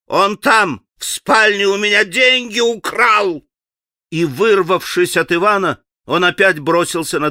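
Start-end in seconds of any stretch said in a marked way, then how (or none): 0.1-3.4 put-on panicked old man yelling; shrieking accusation. A narrator's reading. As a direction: shriek that he was robbed.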